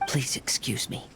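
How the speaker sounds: breathy aside before the strike; whisper. Acted. Polite whisper before knocking him out. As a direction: hush it out.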